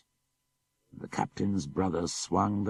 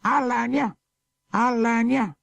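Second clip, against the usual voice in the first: much higher, louder squawking bird voice; cackling parrot; 6 dB louder than usual. Acted. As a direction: screech it like a mocking parrot.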